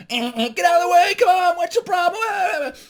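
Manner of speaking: high, loud yelling of honking motorists; irate drivers. Acted. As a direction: yell over car horns in a traffic jam. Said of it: "there's people beeping their horns".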